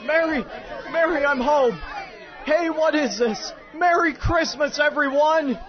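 high, exuberant calling; jubilant homecoming. Acted. George arriving home to his family, jubilant. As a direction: call through the house, bursting with joy.

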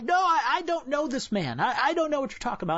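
acted flustered denial, high and stumbling; lightly panicked, deny it quickly; nervous denial. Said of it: "Peter was nervous. I can only imagine how scared".

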